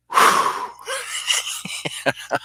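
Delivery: high breathless giggle; high laugh. Real, not acted. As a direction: burst into a wheezing high laugh. They all started laughing.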